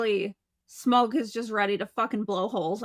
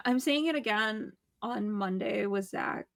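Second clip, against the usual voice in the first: usual speaking voice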